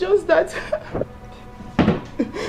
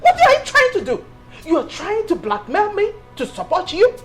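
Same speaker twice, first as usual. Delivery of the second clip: high, loud, indignant demand; angry outburst; 7 semitones higher, 4 dB louder than usual. Acted. Mother-in-law accusing wife of blackmail, angry outburst. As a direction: raise the voice in accusation. Confrontation escalates.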